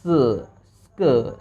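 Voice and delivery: teacher stretching स्क with a half स; half sound स्क. Shown as a demonstration. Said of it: "पहले वाले consonant का आधा आवाज़ हो जाएगा".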